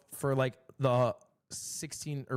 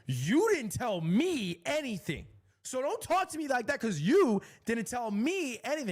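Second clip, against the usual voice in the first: indignant retort; 8 semitones higher than usual. Retold or imitated. Lady snapping back.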